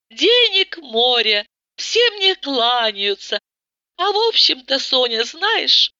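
lightly voiced rich wife, complaining; a hint of a spoiled, weary friend; Tata, bored and sad. A narrator's reading. Tata continues talking.

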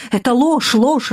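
shouted denial. A narrator's reading. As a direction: cry it out as a crowd. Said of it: "раздались голоса".

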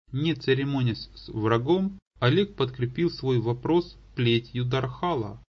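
ordinary delivery

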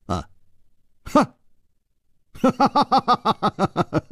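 高声连串的哈哈笑; 得意地笑出声来. A narrator's reading. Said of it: "微笑着说". (楚留香惊喜地笑，高声哈哈)

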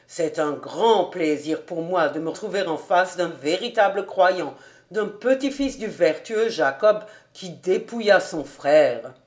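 ordinary delivery, nothing marked

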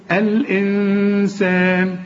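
الإنسان very slow with the opening hamza said; hamza at start. Shown as a demonstration. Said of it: "لو بدأنا". (نطق الإنسان ببطء بهمزة الابتداء)

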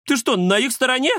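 high outraged squeak; indignant. Acted. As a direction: protest in disbelief. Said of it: "возмутился Кузя".

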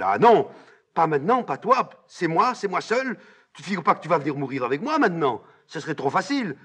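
high, loud, vehement rejection; angry refusal. Acted. Antigone repousse Ismène. (refus véhément, presque crié)

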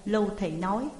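plain reading voice

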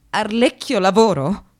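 ordinary delivery

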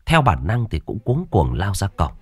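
ordinary delivery, nothing marked

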